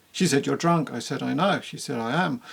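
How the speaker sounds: lightly imitating a monotone author reading his own book. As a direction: read every line of the dialogue with no change in voice. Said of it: "there are no changes in his voice at all".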